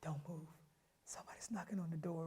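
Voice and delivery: hushed, voiceless and quick; scared whisper. Retold or imitated. Player whispering to roommate, hiding after curfew. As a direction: whisper fast so nobody outside hears.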